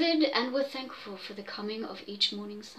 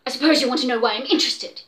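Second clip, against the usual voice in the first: louder and fast, biting it off; snapping; 7 dB louder, much faster than usual. Acted. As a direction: snap it at the doctor. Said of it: "he snapped".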